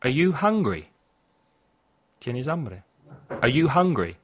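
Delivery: normal talking voice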